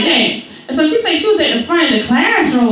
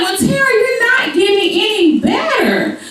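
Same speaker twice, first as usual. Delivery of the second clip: high, lightly stern teacher; a touch of a disappointed teacher; 11 semitones higher than usual. Retold or imitated. Teacher telling Tara she isn't improving, high.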